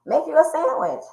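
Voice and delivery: high, quick mocking reply; sassy retort. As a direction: brush him off with sass. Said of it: "I said".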